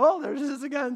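high, loud oh there she is; hammed-up exasperated husband; mock surprise. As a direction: feign astonishment at yet another baby. Mock surprise at another pregnancy.

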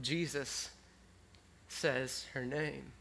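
ordinary talk

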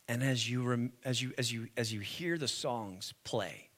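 low, very soft invitation; hushed preaching. Real, not acted. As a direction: draw the congregation in quietly.

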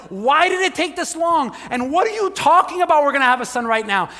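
high, loud, baffled questions; mock disbelief, voice jumping high; incredulous questions. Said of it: "I have so many questions".

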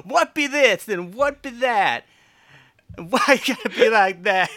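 high, loud jive-talk voice; hammed-up Paul rap; [name] impression. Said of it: "doing it like Paul".

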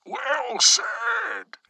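high, loud approving yell. A narrator's reading. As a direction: cup your hands and yell. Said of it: "Bertrand yelled".

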